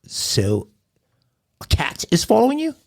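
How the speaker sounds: put-on British accent, incredulous rise; British dispatcher. Dispatcher questioning the caller, incredulous British accent. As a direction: ask in British accent, disbelieving.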